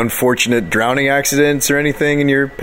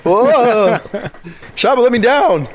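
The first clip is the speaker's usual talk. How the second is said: high, startled yelps; alarmed protest; 10 semitones higher, 3 dB louder, slower than usual. Acted. Character hoisted up by Shaba, playing alarmed protest. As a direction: cry out in hammed-up alarm.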